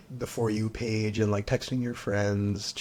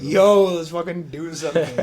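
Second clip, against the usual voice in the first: louder, higher mock call to go out; restless outburst; 5 semitones higher, 8 dB louder than usual. Stuck home alone a week, begging friends to go out, loud and restless. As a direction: blurt it like you're going stir-crazy. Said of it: "I wanted to go outside and be like".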